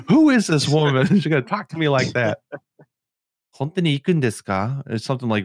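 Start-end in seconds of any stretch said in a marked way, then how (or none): 0.1-2.4 higher, louder mock indignation; upset daughter. Retelling daughter's outburst at the handler, mock upset. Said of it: "Yuna got so upset". 3.6-4.8 higher-pitched, imitated condescension; condescending Japanese line. Quoting the handler's Japanese line, condescending. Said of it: "really condescending".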